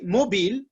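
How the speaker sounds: "mobile" ending in "-beel", as in French; French-style mispronunciation. Shown as a demonstration. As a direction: say "mobile" the French way, rhyming with "feel". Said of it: "many people say mobile instead of mobile".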